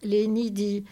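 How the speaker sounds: ordinary delivery, nothing marked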